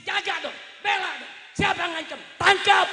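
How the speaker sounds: high, loud orders building to a yell; exasperated, rising-volume commands; indignant shout. Real, not acted. Indignant orders about police duty.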